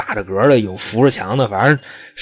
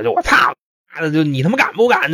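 模仿喊叫; 5 semitones higher than usual. Retold or imitated. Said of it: "嘴里就喊着".

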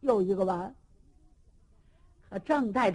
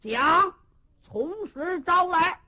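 stern demand; 7 semitones higher, slower than usual. Acted. Cheng Yaojin ordering the prisoners to confess, slow and stern. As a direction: lay down the order like a judge.

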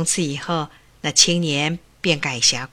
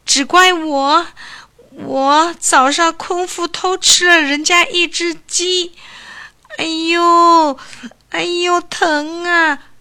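put-on high, slow, pained young man's voice with groans; a hurting young thief, pleading and groaning; 10 semitones higher, 6 dB louder, slower than usual.

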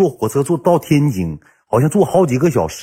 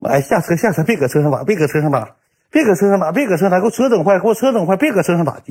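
higher-pitched, urgent repeated scolding; imitated flustered driver; 7 semitones higher than usual. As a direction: mimic a driver hurriedly ordering passengers out, voice climbing. Acts out driver ordering them out. (模仿司机着急地高声赶人)

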